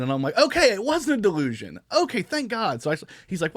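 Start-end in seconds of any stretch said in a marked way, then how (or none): 0.4-2.8 high, loud acted relief; animated retelling, pitched right up; relieved exclamation. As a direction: burst out as if finally vindicated.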